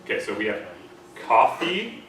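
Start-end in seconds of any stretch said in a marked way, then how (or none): 1.3-2.0 coffee, American vowel, higher and louder; American accent coffee, rising. Shown as a demonstration. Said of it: "That's how we say it in American".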